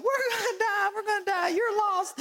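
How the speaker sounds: very high mock crying; hammed-up tearful shriek; imitating panicked child. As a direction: wail like a frightened kid. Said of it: "And then Noble's like".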